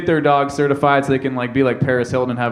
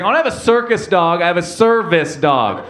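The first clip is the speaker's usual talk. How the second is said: high, exasperated correction slowing at the end; mad dog owner; 4 semitones higher than usual. Retold or imitated. Dog lady correcting circus dog mistake, exasperated. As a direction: spell out "service" in exasperation. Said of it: "so fucking mad".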